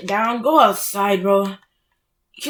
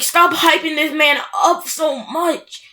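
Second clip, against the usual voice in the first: ordinary talk, nothing marked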